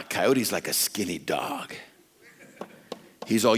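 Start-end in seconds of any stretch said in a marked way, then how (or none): none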